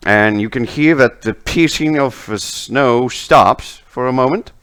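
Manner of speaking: ordinary talk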